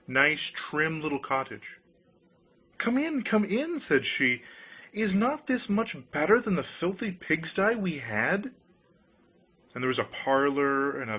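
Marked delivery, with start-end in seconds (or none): 2.8-3.8 high, bright-pitched wife greeting; wife's welcome. A narrator's reading. Wife greets her husband at the new cottage, high and pleased. 4.9-8.5 higher-pitched boastful wife; proud wife. A narrator's reading. Wife boasts to the fisherman about the cottage, higher and proud.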